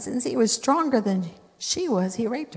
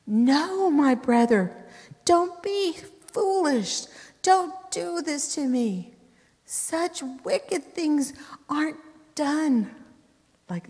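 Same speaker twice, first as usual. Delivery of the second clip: high, slow pleading plea; pleading Tamar; 8 semitones higher, slower than usual. Acted. Tamar begging her brother to stop, high and slow.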